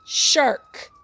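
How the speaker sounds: high squeaking word; squealed. Real, not acted. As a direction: squeal the word in fright-fun. Reaching shark page.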